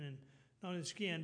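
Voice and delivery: ordinary talk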